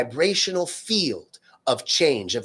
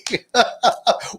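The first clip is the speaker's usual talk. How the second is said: much higher, loud burst of laughter; high cackling laugh; 10 semitones higher, 5 dB louder than usual. Retold or imitated. Friend laughing about her ex-husband, high cackle. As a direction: break into helpless giggles.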